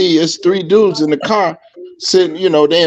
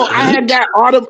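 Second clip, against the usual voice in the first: ordinary talk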